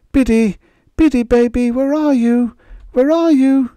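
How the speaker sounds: high, loud calls into the night; calling out. Acted. Mother calling into the desert night for her lost daughter, loud and high. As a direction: call out for the lost child. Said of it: "she began calling".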